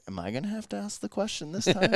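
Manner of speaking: high-pitched quoted inner voice; mock worried thought. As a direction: act out a playful little panic. Acting out own worried inner reaction.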